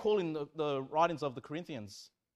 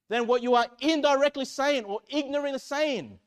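raised, emphatic pulpit voice; forceful preaching; 8 semitones higher, 11 dB louder than usual. Real, not acted. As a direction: hammer out the argument loudly.

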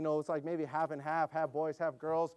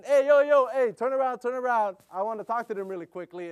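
high, loud call down the street; yelling at son Dustin to turn back; 6 semitones higher, 9 dB louder than usual. Retold or imitated. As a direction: yell to get them to turn around. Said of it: "So I'm yelling at my boys".

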